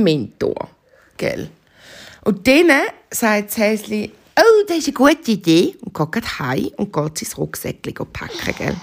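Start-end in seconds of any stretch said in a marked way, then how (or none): 4.4-5.8 excited bunny. Acted. Bunny happily agrees.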